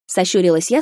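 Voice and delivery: ordinary delivery, nothing marked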